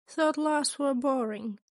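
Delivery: ordinary delivery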